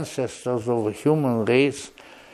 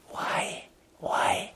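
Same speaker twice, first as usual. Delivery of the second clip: hushed, whispered why; whispered question; 9 dB quieter than usual. Real, not acted. Lecturer asking the audience a rhetorical question, whispering. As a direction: drop to a whisper for effect.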